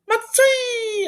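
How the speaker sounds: Matsui sung out in a very high falsetto; a playful falsetto Matsui; high squeaky Matsui. Shown as a demonstration. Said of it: "I like to say".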